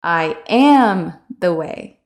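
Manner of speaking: am leaned on, slow; "am" stressed, slow and falling. Shown as a demonstration. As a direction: push the word am. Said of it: "emphasize specific words".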